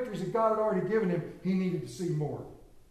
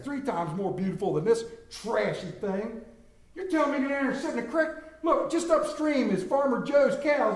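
mad and haughty; 5 semitones higher than usual. Acted. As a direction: sneer at the creek and storm off. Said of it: "And he's so mad".

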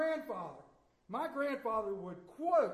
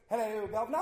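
a light mock English accent, a touch louder; playful English accent greeting; 6 dB louder, faster than usual. Pastor answering the phone in a mock English accent. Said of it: "I'll answer you in an English accent".

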